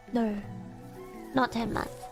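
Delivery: usual speaking voice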